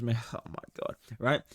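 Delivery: normal talking voice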